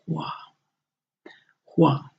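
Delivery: "hua" with the tone on the middle vowel; Chinese hua. Shown as a demonstration. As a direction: model "hua" for students. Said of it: "the tone is on this middle vowel".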